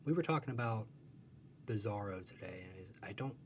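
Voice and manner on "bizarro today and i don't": ordinary talk, nothing marked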